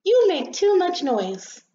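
high, slow, indignant complaint; annoyed scolding. Acted. Rosebud tells off the noisy rain, annoyed.

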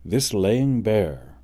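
usual speaking voice

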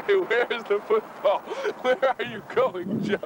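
slow, giggling "where's the football"; stoned mock question, laughing. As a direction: ask it dazed and laughing.

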